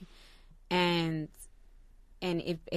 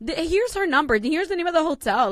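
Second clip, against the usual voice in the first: much higher and louder, eager mom voice; chirpy helpful mom; 9 semitones higher, 8 dB louder than usual. Retold or imitated. Mom cheerfully hands the boyfriend her number, high and eager. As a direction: play the mom happily giving away everything.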